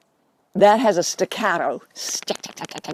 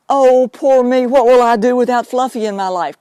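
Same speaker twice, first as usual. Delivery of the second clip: hammed-up poor-me wail, louder; mock self-pity; 3 semitones higher, 6 dB louder than usual. Medium mimics a pet owner wallowing, hammed-up whiny wail. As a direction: overplay the helpless grieving owner. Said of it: "That's all energy that pushes Fluffy away".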